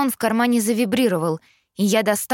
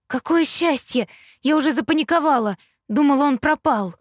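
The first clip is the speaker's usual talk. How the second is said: relieved; 6 semitones higher than usual. A narrator's reading. Relief after panic.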